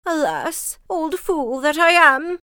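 put-on high, loud old woman's lament; crying out in dismay. A narrator's reading. Old woman finds her ox gone, crying out. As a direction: wail it, drawing out the words. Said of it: "cried she".

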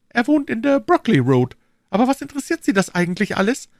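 hohe, helle Mädchenstimme; junge Frau. A narrator's reading. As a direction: Lizzie als junge Frau sprechen. Lizzie answers, irritated. (hohe Stimme einer jungen Frau)

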